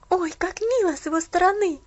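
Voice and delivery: high, delighted exclamation; exclaiming. Acted. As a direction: burst out with glad delight. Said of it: "воскликнула Полианна".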